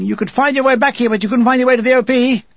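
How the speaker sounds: high, rapid officer's tirade; threatening shout. A narrator's reading. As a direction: berate the soldier in a rush. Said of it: "He's shouting at me and threatening me".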